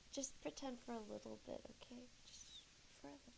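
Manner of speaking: fading from soft to whispered; fading to hush. Real, not acted. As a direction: trail off into a whisper. Asks chat to pretend.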